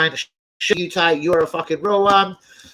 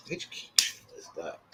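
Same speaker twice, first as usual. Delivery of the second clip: whispered to himself, much softer; 10 semitones lower, 11 dB quieter than usual. Real, not acted. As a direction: breathe the question under your breath. Repeats question, second time whispered.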